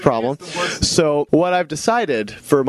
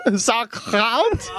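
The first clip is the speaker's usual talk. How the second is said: higher comic German, questioning; mock German; 7 semitones higher than usual. Host pitching German names, mock German accent. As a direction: offer it as silly German with a laugh. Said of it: "doing the entire show in German".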